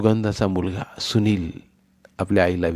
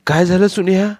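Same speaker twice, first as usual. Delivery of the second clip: concerned asking; 11 semitones higher, 6 dB louder than usual. Acted. Brother asks crying child.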